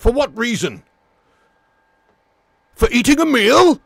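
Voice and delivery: high, loud outraged protest; indignant arrested man. Retold or imitated. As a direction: bellow it like a man wrongly arrested. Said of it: "Do the succulent Chinese meal guy".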